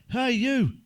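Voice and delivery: loud call across the street; shout. Retold or imitated. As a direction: shout after him, loud. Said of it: "I shouted".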